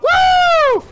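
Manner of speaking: long, loud, very high woo; high-pitched victory cry; celebratory whoop. Real, not acted. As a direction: whoop in triumph. Celebrates a kill.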